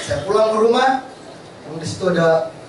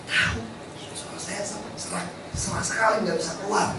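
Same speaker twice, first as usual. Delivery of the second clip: hoarse reply; 3 semitones higher, 8 dB quieter than usual. Retold or imitated.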